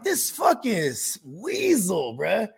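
slow, disgusted muttered insult; mock disgusted aside. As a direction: drag it out like you can't believe this guy. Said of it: "I'm like".

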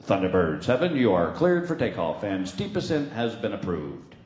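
low, flat tower radio call; control tower radio. Retold or imitated. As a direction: announce it like the tower. Control tower over the helmet radio.

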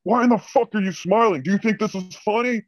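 high mock boss rage; high-pitched caricature of a fuming director; imitating angry boss. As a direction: squeal it like an outraged manager. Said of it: "He's like".

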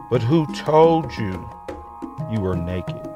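plain reading voice

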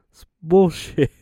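real laugh breaking through the words. Real, not acted. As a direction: stretch it out and crack up. Cracks up at own bit.

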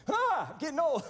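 high, loud comic lament; mock wail. Father working out his future age, mock wail. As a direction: cry out in pretend despair.

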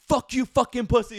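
furious, high shouted insult; high angry shout at the fishermen; angry yell. Retold or imitated. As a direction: yell it like an enraged stranger. Guy insulting them, retold.

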